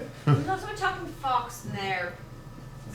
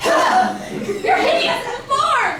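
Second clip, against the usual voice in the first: horrified shout; 9 semitones higher, 10 dB louder, much slower than usual. Acted. Recoiling from ugly creature.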